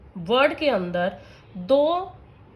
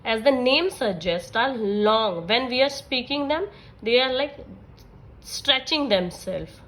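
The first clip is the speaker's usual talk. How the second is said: slow, stretched words; much slower than usual. Shown as a demonstration. Teacher explaining long vowels, stretching her words. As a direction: draw out stretching themselves so it sounds long. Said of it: "Long vowel".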